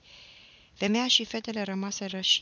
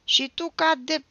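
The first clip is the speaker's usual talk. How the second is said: loud, high stammered insult; flustered boy stumbling over his comeback; stammering; 10 semitones higher, 12 dB louder than usual. A narrator's reading. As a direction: stutter angrily, high and loud. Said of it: "se bâlbâi Nicolae".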